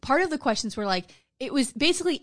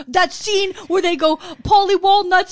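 mock cohost gush; 8 semitones higher, 9 dB louder than usual. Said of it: "This bitch is like".

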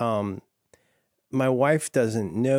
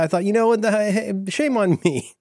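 usual speaking voice

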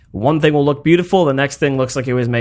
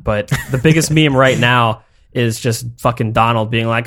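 ordinary talk

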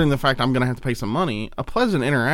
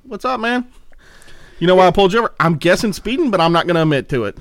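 lightly voiced cop; 6 semitones higher, 4 dB louder than usual. Voicing the cop, then himself.

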